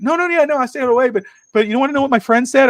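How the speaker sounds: put-on high, hurried kid chatter; eager daughter. Daughter telling Dad about her friend, high eager voice. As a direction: imitate a girl bursting to share gossip.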